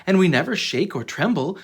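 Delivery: usual speaking voice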